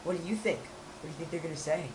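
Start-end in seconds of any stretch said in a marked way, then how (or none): none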